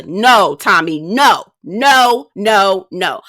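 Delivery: emphatic correction to the host; firm repeated no. Real, not acted. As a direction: shut him down, no after no. Correcting host Tommy firmly.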